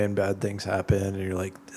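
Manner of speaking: ordinary delivery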